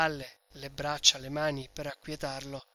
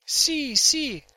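much higher and louder, blurted; hasty frightened yes; 10 semitones higher, 8 dB louder than usual. A narrator's reading. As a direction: blurt it out to calm him. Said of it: "rispose precipitosamente Lucia".

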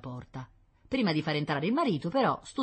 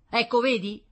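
loud, higher triumphant exclamation; thundering; 9 semitones higher, 8 dB louder than usual. A narrator's reading. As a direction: thunder it out as proof. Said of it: "tonò l'astuto Ulisse". (esclamazione tonante)

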